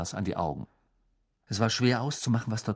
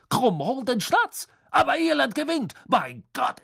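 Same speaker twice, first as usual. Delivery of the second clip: astonished announcing; 14 semitones higher than usual. A narrator's reading. Beckmann announces the surprise ending, amazed high call. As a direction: proclaim it as if hardly believing it. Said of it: "wie die Iren vom plötzlichen Ende des Spiels überrascht".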